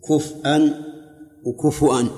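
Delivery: كفءا with a stop on the hamza, then وكفؤا with u before the hamza; other readings. Shown as a demonstration. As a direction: contrast كفءا and وكفؤا clearly. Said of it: "ثلاث قراءات".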